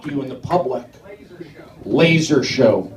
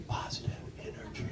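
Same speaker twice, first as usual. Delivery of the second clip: mystical whisper; 6 semitones lower, 22 dB quieter, faster than usual. Acted. Whispered mystical voiceover line.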